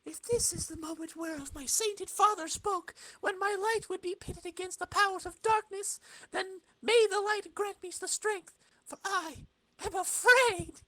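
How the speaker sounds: high, earnest heroine's voice; a young hero bracing herself against darkness. Acted. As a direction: speak up high and sincere like a noble heroine. Reading a heroine's comic lines.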